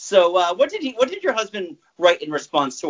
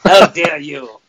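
a mock-offended French accent, louder; 7 dB louder, slower than usual. Joining the accent joke with the cohosts, mock French indignation. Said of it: "it'd be a French accent".

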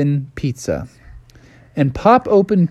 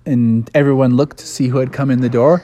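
ordinary delivery, nothing marked

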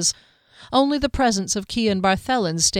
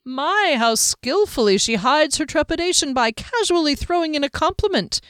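high-pitched, playful astonishment; teasing show of being amazed; mock amazement; 9 semitones higher than usual. A narrator's reading. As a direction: marvel in exaggerated wonder. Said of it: "said to Valraker with mock amazement".

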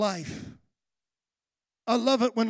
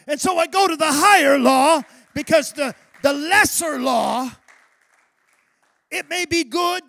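loud, rising preacher's cry; fiery pulpit preaching; preaching at full force; 5 dB louder than usual. Acted. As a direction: preach it out to the congregation. Preaching builds up.

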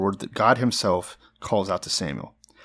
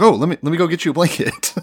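put-on higher, louder oblivious teen; eager high schooler; 7 semitones higher, 6 dB louder than usual. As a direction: offer help like a clueless boy. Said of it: "he's like the, uh, high school kid".

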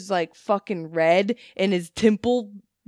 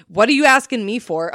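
confrontational; 8 dB louder than usual. Retold or imitated. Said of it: "she was confrontational as fuck".

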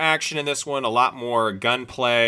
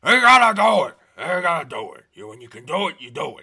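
Al Pacino; 3 semitones higher, 6 dB louder than usual. Acted. Co-host obliging before the next trailer, hammed-up Al Pacino voice. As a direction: blast the opening line, then ease down in character. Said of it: "before you do an Al Pacino voice".

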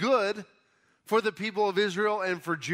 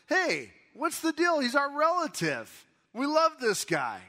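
indignant high retort; 10 semitones higher than usual. Acted. People of Judah retorting in quote.

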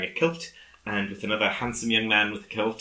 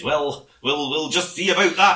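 higher, louder huffy policeman; mock indignant sergeant; 8 semitones higher, 7 dB louder than usual. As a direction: puff up like an offended officer. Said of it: "And he says".

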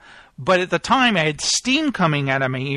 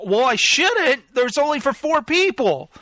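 indignant protest; 7 semitones higher than usual. Retold or imitated. Acted argument over tickets.

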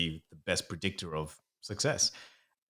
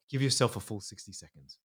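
ordinary talk, nothing marked

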